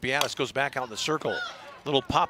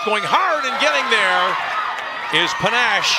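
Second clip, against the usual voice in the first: excited play-by-play call; 6 semitones higher, 7 dB louder than usual. Real, not acted. Announcer calling the inning-ending catch to listeners, loud and excited. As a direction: shout the big catch home as it happens. Big defensive play called live.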